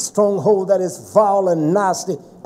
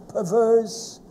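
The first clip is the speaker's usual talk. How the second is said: normal talking voice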